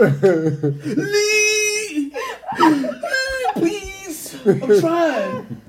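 high, loud desperate pleading; pleading kid. Pretend. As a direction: beg while laughing. Kid pleading with angry parent.

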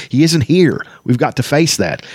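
heated, emphatic falling phrases; angry, flushed. A narrator's reading. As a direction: drive it home in frustration. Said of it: "Sturm said, his face flushed".

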